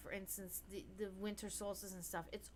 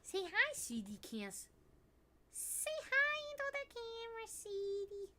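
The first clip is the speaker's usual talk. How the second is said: high pet voice coaxing; 12 semitones higher than usual. Real, not acted. Owner coaxes her cat to greet the camera, high pet voice. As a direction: coo at the cat.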